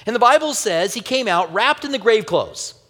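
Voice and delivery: normal talking voice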